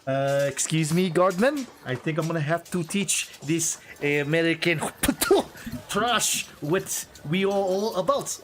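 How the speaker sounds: high, haughty French sneer with a laugh; mock French accent. French noble mocking the American player in French accent. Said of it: "he's like".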